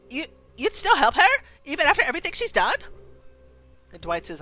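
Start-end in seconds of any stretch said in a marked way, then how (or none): 0.1-2.8 high fast disbelieving; can't believe it; incredulous. Acted. Daughter questioning Dwight, high fast disbelief.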